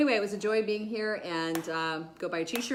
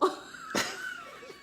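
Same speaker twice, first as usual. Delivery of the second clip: snort-laugh, high and sudden. Real, not acted. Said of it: "I'm snorting again".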